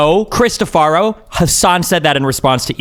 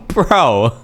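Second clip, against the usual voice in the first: ordinary talk, nothing marked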